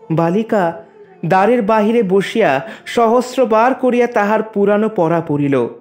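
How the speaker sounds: ordinary delivery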